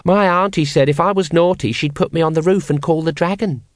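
put-on matter-of-fact small child; conversational child. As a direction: chat away like a little child. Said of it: "said the child conversationally".

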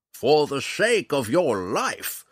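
loud, high, slow battle taunt; drawn-out gleeful challenge; laughing retort. A narrator's reading. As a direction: bellow it with relish as the old ranger. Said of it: "retorted with a laugh that came straight from his belly".

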